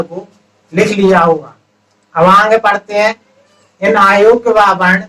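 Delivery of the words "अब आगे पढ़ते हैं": normal talking voice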